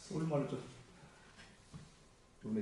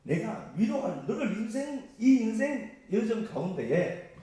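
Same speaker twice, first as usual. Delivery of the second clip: emphatic reassurance, building higher and louder; God; 5 semitones higher, 6 dB louder than usual. Retold or imitated. Preacher voicing God comforting the believer, emphatic and insistent. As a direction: insist with rising force, stretching key words. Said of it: "그 얘기하잖아요".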